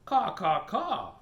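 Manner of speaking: loud cawing crow calls; crow caws. Acted. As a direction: caw like a crow.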